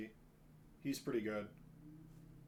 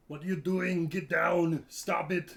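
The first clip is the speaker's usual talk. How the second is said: louder, slightly higher action-hero voice; Arnold; 4 semitones higher, 5 dB louder than usual. Retold or imitated. Showing his Arnold impression to friends, loud. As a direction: bark it like the famous bodybuilder actor. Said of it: "I can do Arnold".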